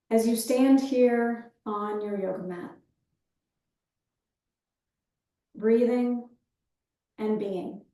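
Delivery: calm breath-awareness cueing, easing down at phrase ends; guided meditation. Real, not acted. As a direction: guide them gently into the breath, leaving space after each phrase.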